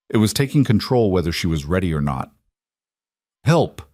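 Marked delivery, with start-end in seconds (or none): 3.4-3.8 cry for help. A narrator's reading. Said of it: "came out as barely a whisper".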